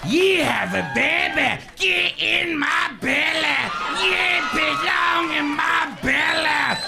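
high, slow, drawn-out hammed-up bellow; ravenous glutton. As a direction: howl hungrily, stretching every word. Acts a greedy film figure.

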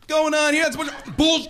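loud, high indignant protest; comic exasperated yelling; mock outrage. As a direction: blurt it out like an outraged viewer. Imagined outraged viewer, swearing bleeped.

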